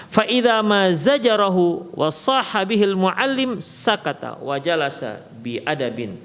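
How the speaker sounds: ordinary talk, nothing marked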